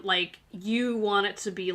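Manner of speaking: ordinary talk, nothing marked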